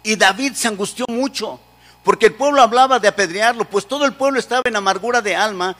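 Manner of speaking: usual speaking voice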